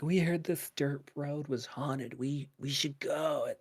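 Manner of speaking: soft, slow imitation of friends; hushed mock suggestion. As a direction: voice a spooky proposal, softly and slowly. Voices friends proposing a haunted outing.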